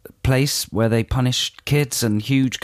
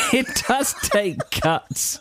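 laughing; 11 semitones higher, much slower than usual. Real, not acted. Laughing at guts joke.

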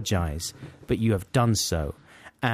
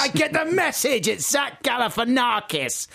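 exasperated shout; 12 semitones higher than usual. Acted. Co-host nagged by repeated pronunciation clips, exasperated shout. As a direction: yell it like you've had enough.

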